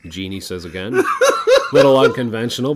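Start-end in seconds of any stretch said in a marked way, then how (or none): 1.1-1.7 high, loud burst of laughter; cracking up at own joke. Real, not acted. Teller cracks up at his own joke, laughing. 1.7-2.8 laughing through the genie's line; loud, can't hold the laugh in. Real, not acted. Teller voicing the genie while laughing hard.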